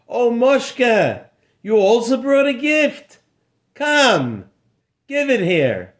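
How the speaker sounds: lightly voiced eager doorman; friendly doorman voice. Doorman greets Moshe.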